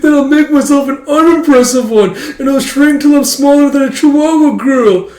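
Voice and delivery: high, loud mock wail; hammed-up pleading at full pitch; desperate whining. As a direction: whine it like an overwrought little brother. Brother's desperate childish plea.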